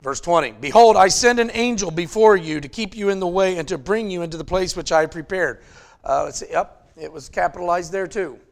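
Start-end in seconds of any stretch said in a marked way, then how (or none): 0.5-5.6 raised, louder reading voice; scripture reading. Acted. Preacher reading verse twenty aloud, raised and proclaiming.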